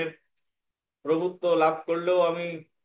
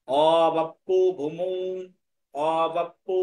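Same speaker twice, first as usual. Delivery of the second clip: long, held vowels in অবাপ্পু; measured Sanskrit recitation; slow pronunciation model; much slower than usual. Shown as a demonstration. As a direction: stretch the syllables for pupils to follow. Teacher models next verse words.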